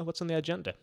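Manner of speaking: ordinary delivery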